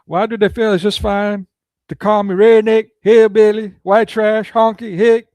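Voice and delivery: put-on hillbilly accent building to a loud, high rant. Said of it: "I can't do a hillbilly accent too well".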